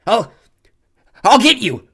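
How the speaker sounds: loud furious threat; girl threatening the bully after collapsing. A narrator's reading. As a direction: vow revenge, winded. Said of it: "she gasped".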